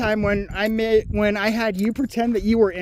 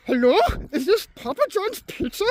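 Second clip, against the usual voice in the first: higher, put-on thick Indian accent; mock Indian accent; 7 semitones higher than usual. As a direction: play Raj faking a thick Indian accent. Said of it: "affect the thick, almost indecipherable Indian accent".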